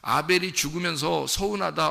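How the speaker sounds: ordinary talk, nothing marked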